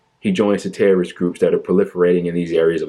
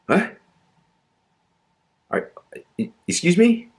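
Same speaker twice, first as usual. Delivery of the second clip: much higher, stunned disbelief; can't believe what you just heard; squeaky disbelieving excuse me; incredulous; 13 semitones higher than usual. Real, not acted. Incredulous reaction to claim.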